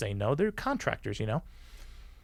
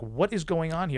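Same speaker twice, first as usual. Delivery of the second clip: a hint of a stern father, a bit higher; stern dad voice; 6 semitones higher than usual. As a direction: scold him like a mildly strict dad. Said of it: "almost like a dad".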